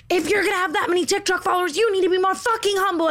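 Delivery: fast, high-pitched mock screeching; retold screaming. Angry girl screaming at her while dragged out, high mock scream. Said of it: "she's screaming at me".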